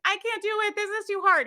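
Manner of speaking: high panicky whine of the brain; the brain panicking in a high voice; anxious brain voice. Retold or imitated. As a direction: squeal it like a scared inner voice. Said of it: "Your brain's gonna be like".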